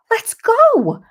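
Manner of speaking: high, loud retold demand to move; urgent shout. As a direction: shout it as a frantic mother would. Mother urging ambulance to leave.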